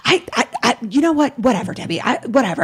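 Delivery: sputtering imitation of a fed-up mother; retold outburst. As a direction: imitate a mother losing it, stammering then dismissive. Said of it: "just loses it on me".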